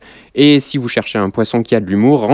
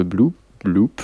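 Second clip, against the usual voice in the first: soft low blub blub sounds; bubbling noise; 5 semitones lower, 5 dB quieter than usual. Retold or imitated. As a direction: imitate a mic bubbling underwater. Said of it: "mon micro bleu a commencé à faire".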